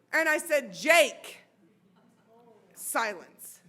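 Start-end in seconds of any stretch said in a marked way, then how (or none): 0.7-1.4 loud, one-word summons; calling out sternly. Retold or imitated. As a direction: call the son out through the door. Mother calls out her son.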